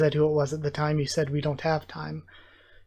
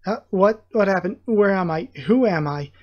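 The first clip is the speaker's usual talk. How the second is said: sudden confused outburst on waking; startled confusion; 3 semitones higher, 4 dB louder than usual. Acted. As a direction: wake up dazed and alarmed. Character wakes up confused.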